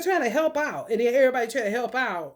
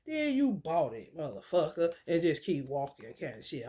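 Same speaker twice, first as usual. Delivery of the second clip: ordinary talk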